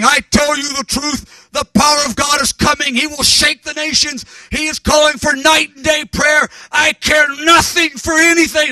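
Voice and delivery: put-on high, loud preacher with a South African accent. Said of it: "beautiful South African accent".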